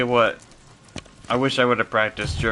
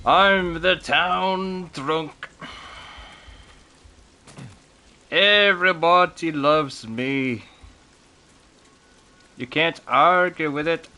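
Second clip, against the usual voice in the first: hammed-up, higher, slow Irish brogue; really bad Irish accent; 5 semitones higher, much slower than usual. Town drunk boasting everybody loves him, bad Irish accent. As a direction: badly fake an Irish town drunk, drawing it out. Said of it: "that was my really bad Irish accent".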